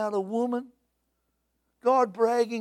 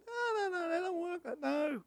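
mock whimper; 9 semitones higher, 9 dB quieter than usual. Mock refusal scene.

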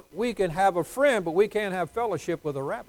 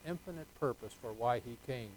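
normal talking voice

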